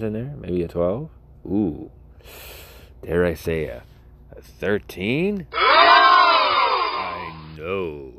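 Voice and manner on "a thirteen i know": louder, higher, over-the-top amazed; hammed-up thrill; mock excitement. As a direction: gasp at a tiny number.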